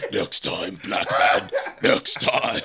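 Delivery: low, breathy cartoon taunt; exaggerated cartoon intonation. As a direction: taunt like a cartoon villain driving off. Cartoon villain voice, fleeing car.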